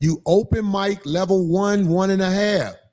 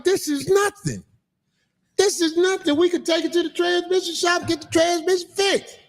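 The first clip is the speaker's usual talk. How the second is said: higher, eager mock reassurance; eager reassuring self; 9 semitones higher than usual. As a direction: play your younger self, eager and reassuring. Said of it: "I was like".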